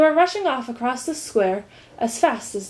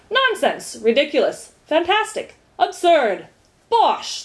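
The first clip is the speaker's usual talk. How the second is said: punchy, higher one-word dismissals; chorused dismissals; 7 semitones higher, 4 dB louder, slower than usual. A narrator's reading. Ministers reject Milo's suggestion, emphatic chorus. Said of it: "they chorused again".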